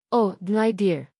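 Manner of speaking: sugary fake sympathy, lightly voiced; mock-sorry with a sly sweetness; a hint of false pity; fake concern. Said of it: "dripping with fake concern".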